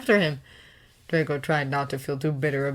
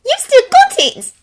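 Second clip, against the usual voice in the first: much higher, loud accusing cry; a young woman calling out her sudden realisation, loud and high; called out; 13 dB louder than usual. A narrator's reading. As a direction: catch the thief out with a sharp high call. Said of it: "Hermione called".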